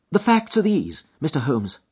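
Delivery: resolved client. Acted. Husband finally opening up to Holmes, firm. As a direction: throw reserve to the winds. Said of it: "with a fierce gesture".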